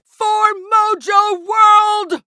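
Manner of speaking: full shout, very high and drawn out; long, soaring war shout; battle cry. Acted. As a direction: charge into the fight yelling.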